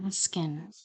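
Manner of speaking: "asking" whispered breathily, vowel softened, a small rise at the end; whispered mimicry. Commentator mocking the witness's word under her breath, whispered. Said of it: "Do you hear how she's saying that".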